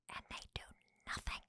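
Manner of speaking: hushed, half-whispered exasperation; frustrated whisper. Acted. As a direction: whisper it like a frustrated thought kept private. Voicing silent frustration about them.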